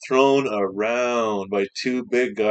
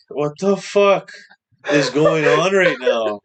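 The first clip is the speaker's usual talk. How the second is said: stunned disbelief; 6 semitones higher, 9 dB louder, slower than usual. Retold or imitated. Retelling his shock at the banana.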